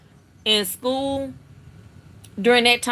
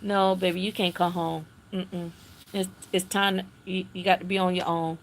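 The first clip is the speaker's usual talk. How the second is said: soft firm no; 5 semitones lower, 7 dB quieter than usual. Retold or imitated. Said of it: "At that time".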